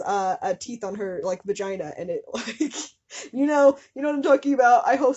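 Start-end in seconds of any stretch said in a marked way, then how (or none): 3.3-5.2 loud, fast, rising appeal; excited rush; eager appeal. Real, not acted. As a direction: beg the viewers to remember.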